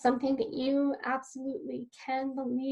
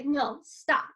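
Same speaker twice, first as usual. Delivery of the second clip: firm stop; 4 dB louder than usual. Shown as a demonstration. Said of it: "physically say".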